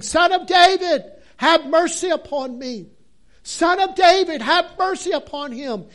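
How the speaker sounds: high, loud pleading cry; desperate shout. Acted. Blind beggar crying out to Jesus, shouting. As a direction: shout it like it's your last chance. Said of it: "he just kept saying".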